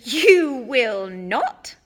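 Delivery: loud, slow sharp snap; snapping. A narrator's reading. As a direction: cut him off sharply. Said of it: "snapped Missus Weasley".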